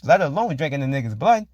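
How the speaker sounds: usual speaking voice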